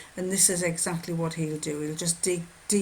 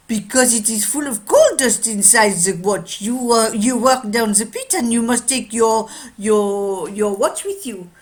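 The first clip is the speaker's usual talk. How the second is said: put-on loud, higher French-accented explanation; 4 semitones higher, 9 dB louder than usual. Watchmaker explaining coal dust to a miner, French accent. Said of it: "a French watchmaker".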